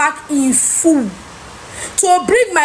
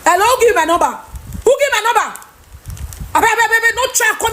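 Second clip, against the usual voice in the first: retold angry outburst; 8 semitones higher, much faster than usual. Retelling her angry phone call.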